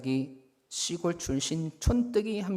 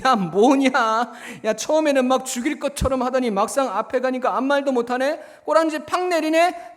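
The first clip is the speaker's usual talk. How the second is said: loud, high-pitched mocking; jeering; 9 semitones higher, 8 dB louder than usual. Friends mocking the bully for backing down, jeering. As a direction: taunt him loudly for backing down. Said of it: "주변 친구들이 막 비웃어요".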